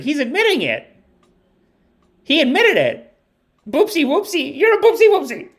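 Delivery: put-on very high, giddy child voice; imitating a child in hysterics; kid losing it. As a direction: squeal it like a gleeful small kid. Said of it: "she was losing it".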